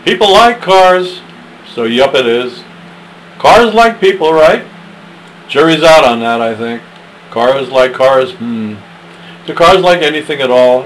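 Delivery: loud, lifted poem recital with stretched words; hammed-up stage recitation; dramatic poem reading. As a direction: read it like a Broadway showpiece. Recites a poem, first take.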